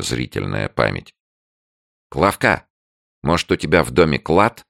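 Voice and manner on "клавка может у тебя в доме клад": amused. A narrator's reading. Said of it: "развеселившись".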